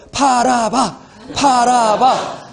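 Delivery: high, slow coaxing chant; audience egging on. Retold or imitated. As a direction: coax him like a teasing crowd. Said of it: "그렇게 얘기하시더라고요".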